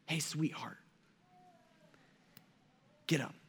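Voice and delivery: put-on soft, tender call to a little girl; endearing. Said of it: "um, is more endearing".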